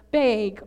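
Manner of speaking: "bag" with an "ay" vowel, like "bayg"; Minnesotan "bag". Shown as a demonstration. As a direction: say "bag" to rhyme with "vague". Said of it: "hard time saying words with A Gs".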